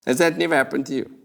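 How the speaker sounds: fast deadpan question; quick aside. Acted. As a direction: toss it off fast.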